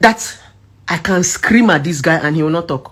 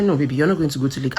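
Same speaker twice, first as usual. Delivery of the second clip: soft, lower and fast; calm firm voice; 5 semitones lower, 7 dB quieter, faster than usual. Retold or imitated. Partner calmly repeating his refusal, soft and quick. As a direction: brush it off quietly but firmly. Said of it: "he say".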